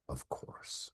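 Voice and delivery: soft unvoiced whisper from beyond. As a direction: breathe it like a ghost. Dead father's ghostly reply.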